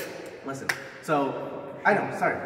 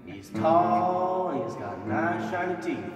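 wrestling promo. Acted.

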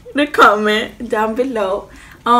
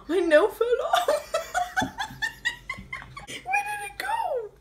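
laughing; 7 dB quieter than usual. Real, not acted. Nail falls off, laughing.

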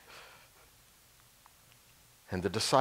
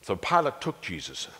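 plain reading voice

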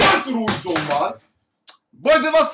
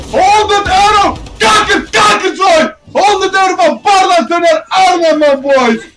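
donation hype shout; 5 semitones higher, 6 dB louder than usual. Real, not acted. Streamer hyping a donation.

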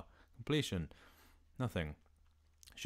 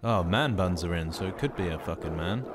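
ordinary talk, nothing marked

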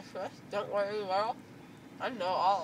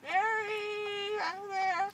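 much higher goofy call; high silly voice; 11 semitones higher, much slower than usual. Pretend. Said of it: "it's gonna be like".

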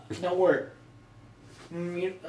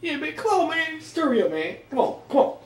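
frantic urging; 7 semitones higher than usual. Acted. Urging partner.

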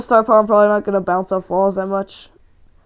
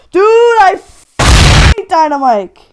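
sudden frustration; 9 semitones higher, 9 dB louder than usual. Real, not acted. Sudden switch to hating Dynamike.

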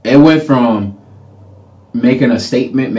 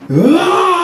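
demo scream; 3 dB louder than usual. Shown as a demonstration. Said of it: "but screaming is just like".

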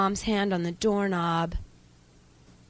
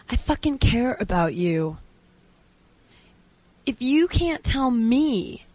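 higher, stretched words of concern; pushy, concerned boyfriend; 3 semitones higher than usual. Acted. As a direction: press them lovingly to open up. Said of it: "He keeps pushing me".

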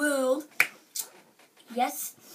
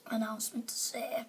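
unvoiced whisper; 3 semitones lower, 12 dB quieter than usual. Real, not acted. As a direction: breathe the words out. Sad announcement, whispered.

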